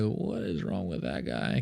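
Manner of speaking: soft, slow mock mutter; quietly puzzled onlookers; bystanders muttering. Drivers in the next car wondering aloud, soft. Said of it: "people would be looking at me being like".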